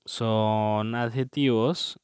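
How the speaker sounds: slow, drawn-out "son adjetivos"; slow emphasis. Shown as a demonstration. Teacher to class, dictating "adjetivos" slowly. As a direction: spell the term out slowly for the class. Teacher stresses key term.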